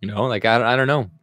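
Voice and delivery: ordinary delivery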